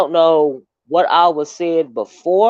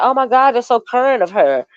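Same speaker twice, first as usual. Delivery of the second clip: mock exclamation; 6 semitones higher than usual. Mocking the buzzword.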